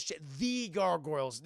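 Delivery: usual speaking voice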